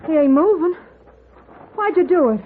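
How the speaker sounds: scared he's killed a man, pushing for an answer; frightened pleading. Acted. Wife panicking over the stranger lying still, high frightened.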